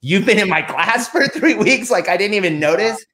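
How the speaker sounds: high, slow astonished question; amazed disbelief. Retold or imitated. Learning the author shared his class, high slow astonishment. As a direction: stretch it out in disbelief. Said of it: "I'm like".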